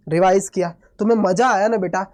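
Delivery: normal talking voice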